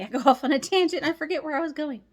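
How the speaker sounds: high, fast, laughing through it. Real, not acted. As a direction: giggle while you confess it. Joking about her own tangents.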